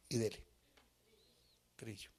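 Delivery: a hushed whispered word. Real, not acted. Preacher whispering to himself about hard training. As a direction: drop to a whisper as an aside. Whispered aside after wow.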